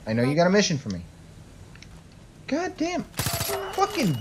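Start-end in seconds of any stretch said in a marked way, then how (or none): 2.5-4.1 high exasperated cursing; frustrated curse. Real, not acted. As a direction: spit it out in frustration.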